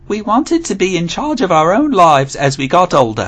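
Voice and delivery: lightly put-on Australian accent; mock Australian accent. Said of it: "that's definitely Australian".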